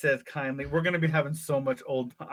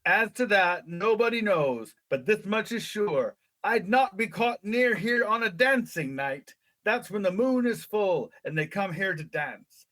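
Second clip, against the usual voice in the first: put-on raised-pitch old English shepherd, warning; funny old-country English accent; 4 semitones higher than usual. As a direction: spin a rustic English folk warning. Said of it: "in that funny accent that all the old people".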